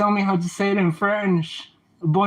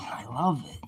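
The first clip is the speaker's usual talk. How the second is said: whisper; 4 semitones lower, 8 dB quieter than usual. Real, not acted. Admiring shiny oven.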